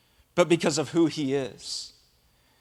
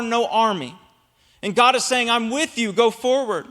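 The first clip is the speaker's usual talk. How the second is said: raised, full-force sermon delivery; preaching; 4 semitones higher than usual. Acted. Pastor preaching to church, full force. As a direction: preach it out to the congregation.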